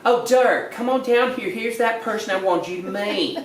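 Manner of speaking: put-on high bright calling of an older Texas lady; her exact voice. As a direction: imitate her, calling him down cheerfully. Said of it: "that's how she sounded, just like that".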